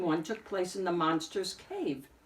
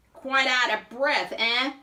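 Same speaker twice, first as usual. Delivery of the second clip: slow, higher, louder landlady voice; teasing landlady; 6 semitones higher, 7 dB louder than usual. A narrator's reading. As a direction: needle the tenant with a knowing tone. Landlady teasing the wolf again.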